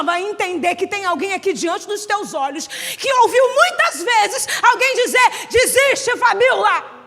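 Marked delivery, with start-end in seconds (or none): 5.5-7.0 loud, slow mocking taunt; loud mocking voices. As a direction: jeer at her to give up. Voices telling her to give up.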